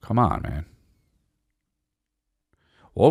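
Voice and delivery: ordinary talk, nothing marked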